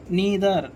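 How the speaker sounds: neither said as NEE-ther; neither, carefully pronounced NEE-ther; correct pronunciation. Shown as a demonstration. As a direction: say neither with a long "ee". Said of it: "neither అనాలి".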